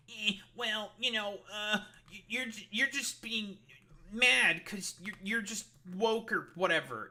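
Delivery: stumbling mock impression of the chatter, slowing on mad; mocking critic.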